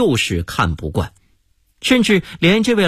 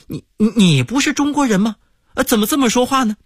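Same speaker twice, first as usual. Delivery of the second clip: higher, fast stammering bewilderment; baffled; 8 semitones higher, faster than usual. Acted. American guest baffled by the waiter's reply, stammering. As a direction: stumble over the words in disbelief. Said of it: "美国人感到莫名其妙".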